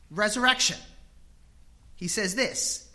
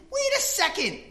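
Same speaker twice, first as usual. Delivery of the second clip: indignant homeowner; 13 semitones higher, 6 dB louder than usual. Retold or imitated. Tired dad home from work protesting at his barking dog, higher and indignant. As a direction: make the dog know who owns the house. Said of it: "I think".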